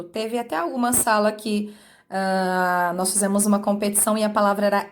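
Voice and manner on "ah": usual speaking voice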